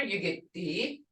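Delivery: ordinary delivery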